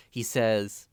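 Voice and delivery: ordinary talk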